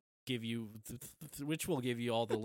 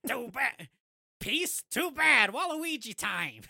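much higher hammy Waluigi voice; 11 semitones higher, 6 dB louder, slower than usual. Acted. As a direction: ham up a cartoon villain, pitched high. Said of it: "That was a bad Waluigi".

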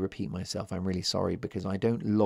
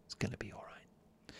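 hushed whisper, reassuring; 17 dB quieter than usual. Shown as a demonstration. Host repeating the comfort line for listeners, whispered. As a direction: breathe it out gently as a whisper. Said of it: "do you like the whisper?".

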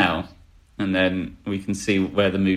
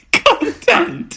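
laughing; 5 dB louder than usual. Real, not acted. Laughing answer.

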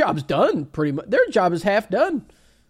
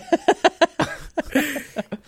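high laugh; 9 semitones higher, 4 dB louder than usual. Real, not acted. Laughing at own quote.

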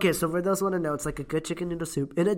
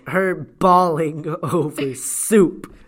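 laughing; 2 semitones higher, 7 dB louder, slower than usual. Real, not acted. Laughing at friend's crying photo.

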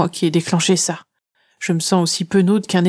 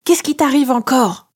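1 grumbling; 7 semitones higher than usual. A narrator's reading. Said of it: "grognai-je en fronçant les sourcils".

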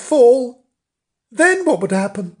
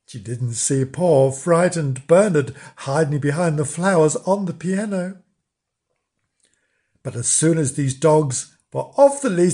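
ordinary delivery

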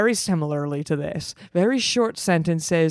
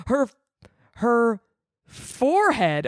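ordinary talk, nothing marked

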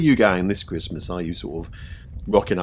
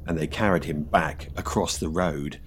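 ghost story narration; 2 semitones lower, 4 dB quieter than usual. A narrator's reading. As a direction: draw out the suspense.

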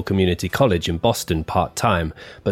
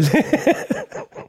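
burst of laughter. Real, not acted. Breaks up mid-sentence.

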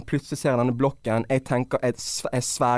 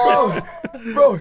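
high, loud bro; exasperated shout; 11 semitones higher, 5 dB louder than usual. Real, not acted. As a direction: cry out in disbelief. Shout of disbelief. (høyt, oppgitt rop)

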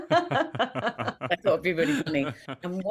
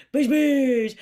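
put-on high, loud baby-talk calling; awful baby voice; 8 semitones higher, 9 dB louder than usual. Calling her cat Bush Bush in baby voice. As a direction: coo the name like to a baby. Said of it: "really weird kind of just awful baby voice".

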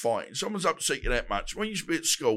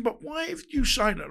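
high incredulous question; 11 semitones higher than usual. Retold or imitated. Retold confrontation.

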